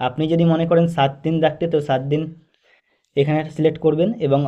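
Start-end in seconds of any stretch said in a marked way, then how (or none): none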